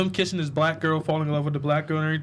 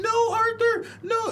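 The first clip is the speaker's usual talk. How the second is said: high, sobbing plea with a cry in it; mock crying; slower than usual. Retelling the midget's plea, mock crying high voice. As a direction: beg like a crying little man. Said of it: "he was in the corner crying".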